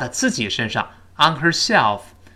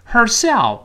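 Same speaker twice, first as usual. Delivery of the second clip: "Herself" stressed, pitched high on SELF; 9 semitones higher, 3 dB louder than usual. Shown as a demonstration. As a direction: punch "Herself" with a clear H. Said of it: "花在自己身上重读了". (重读"Herself"，音调抬高)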